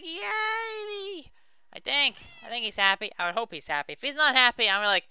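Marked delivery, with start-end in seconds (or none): 0.0-1.3 very high, drawn-out squeal; mock villager cheer. Player voicing the cured villager, high wailing cheer. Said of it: "He's like".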